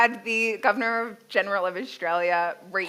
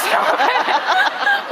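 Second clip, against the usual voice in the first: much higher and louder, breaking into laughter; laughing protest; 7 dB louder than usual. Retold or imitated. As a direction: cry it out while laughing helplessly. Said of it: "And I'm like".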